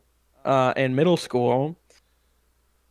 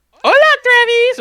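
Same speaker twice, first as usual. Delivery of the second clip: much higher, louder, slow mock-cheerful hello; high cheery greeting; 9 dB louder than usual. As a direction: sing out her name like an overeager greeter. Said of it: "and she's like".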